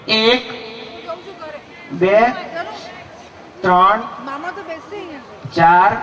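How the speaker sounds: put-on child reciting. Child counts aloud slowly.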